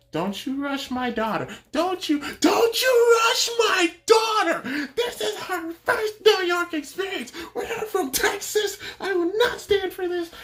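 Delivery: high-pitched outraged mom tirade; mock an irate traveler yelling about her daughter; imitating yelling woman. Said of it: "then she starts, like, yelling".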